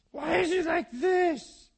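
husband imitates his wife's exasperated outburst. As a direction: ham up a fed-up wife yelling. Mimicking wife's complaint about husband.

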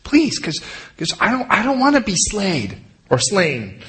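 high mock pleading; pleading brothers. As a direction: beg the steward to put in a word. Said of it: "they have to tell him".

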